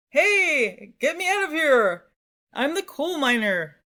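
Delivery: high, loud call for help; coal miner. Pretend. Teacher playing a coal miner calling out, mock shout. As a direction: holler out as a trapped coal miner, hammed-up. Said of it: "imagine a coal miner and you hear his voice".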